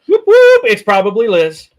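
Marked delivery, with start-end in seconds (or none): 0.1-0.6 high, loud whooping cheer. Real, not acted. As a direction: hype up the viewer. Cheering viewer arrival.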